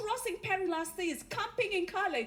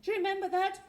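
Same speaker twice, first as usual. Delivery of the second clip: usual speaking voice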